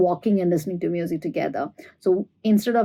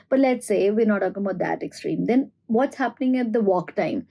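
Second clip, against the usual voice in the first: ordinary talk, nothing marked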